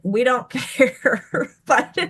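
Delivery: words drawn out through laughter; laughing. Real, not acted. Teacher joking about silly candle names, laughing. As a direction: break up laughing mid-sentence.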